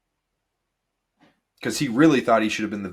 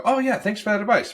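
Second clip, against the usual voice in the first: much higher breezy thank-you; thanking host; 11 semitones higher than usual. Retold or imitated. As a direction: wrap it up like a host moving on. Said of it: "And they go".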